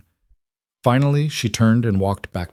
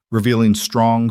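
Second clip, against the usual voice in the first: ordinary delivery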